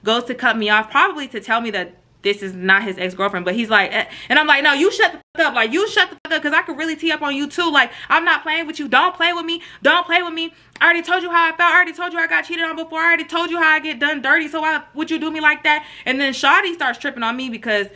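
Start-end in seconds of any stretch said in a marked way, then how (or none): 4.6-15.8 high, insistent rant; heated telling-off. Retold or imitated. Girlfriend refusing to let her man interrupt, high and heated. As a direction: cut him off and keep piling on.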